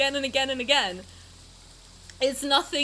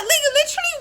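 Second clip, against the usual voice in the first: high and louder; disbelieving outburst; 11 semitones higher, 5 dB louder, much faster than usual. Real, not acted. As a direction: demand an answer. Stunned reaction to plot twist.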